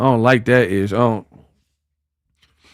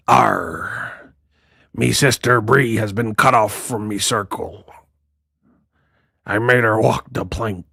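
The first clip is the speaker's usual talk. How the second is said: low, quiet pirate drawl; 3 semitones lower, 4 dB quieter than usual. Retold or imitated. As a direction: talk like a hammy pirate. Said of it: "like a pirate".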